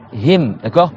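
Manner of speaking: normal talking voice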